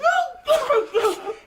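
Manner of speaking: high, gloating laugh; sinister triumphant laugh; villain's laugh. Acted. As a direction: cackle at your own cleverness. Villain gloats over his trap.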